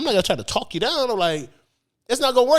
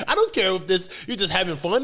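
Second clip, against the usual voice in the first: normal talking voice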